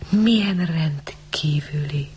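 slow, hushed wonder; marveling whisper. A narrator's reading. Rosemary marvels at the girl, whispering. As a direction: breathe it out in fascination.

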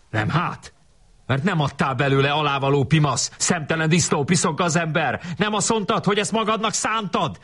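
high, indignant schoolboy tirade; acted furious squabbling without much volume; red-faced yelling. A narrator's reading. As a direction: pile up insults, pitch climbing. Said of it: "kiabált kivörösödve Tök Marci".

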